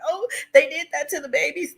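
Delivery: high, wailing mock reaction; retold distress. Streamer reacting to the news, high distressed cry. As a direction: act out being upset by the news.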